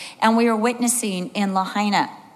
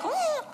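tiny high-pitched squeal; soft, very high mock whimper; helpless whimper; 8 dB quieter than usual. Imitates her helpless panicked self.

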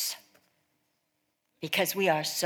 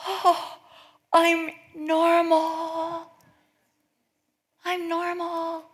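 high, slow, drawn-out relief; hammed-up relief; 9 semitones higher, much slower than usual. Women finding out others do it, hammed-up relief. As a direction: draw it out high, as if hugely relieved.

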